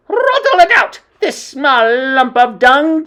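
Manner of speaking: very high, loud screech; screeching witch queen. Acted. Grand High Witch hunting the child, screeching. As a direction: screech the order with fury. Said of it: "screeched the Grand High Witch".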